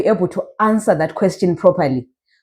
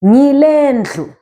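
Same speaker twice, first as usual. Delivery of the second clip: "Ngile ndlu" slow, higher, drawn out; slow teacher's demo of "Ngile ndlu"; model pronunciation; 5 semitones higher, slower than usual. Shown as a demonstration. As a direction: stretch "Ngile ndlu" with raised pitch. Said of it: "You want to say".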